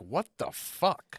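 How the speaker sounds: slow disbelief. Retold or imitated. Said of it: "That was genuinely my angle".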